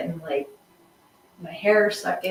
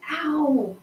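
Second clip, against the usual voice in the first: high wincing ow; sympathetic ow; 8 semitones higher than usual. Pretend. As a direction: wince in sympathy, higher. Reacting to boob injury story.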